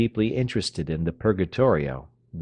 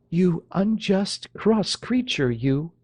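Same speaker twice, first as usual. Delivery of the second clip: high, slow mock scolding; smiling reproach; 11 semitones higher, slower than usual. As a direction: scold him with a smile. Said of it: "with a deprecating smile".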